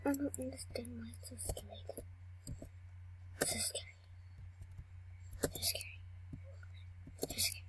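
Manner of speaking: hushed frightened whisper; scared whisper. Real, not acted. Scared player whispering while hiding in the game. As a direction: breathe the words in fear. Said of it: "I'm so scared".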